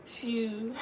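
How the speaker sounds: drawn-out Baltimore vowels; Baltimore accent. Retold or imitated. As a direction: imitate Baltimore speech, stretching the vowel. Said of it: "Well, Baltimore, you already know. They be like".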